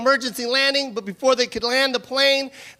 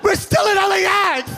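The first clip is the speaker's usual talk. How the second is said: much higher, loud squeal; excited shout; 7 semitones higher, 3 dB louder than usual. Retold or imitated. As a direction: blurt it out thrilled. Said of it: "very funny voice".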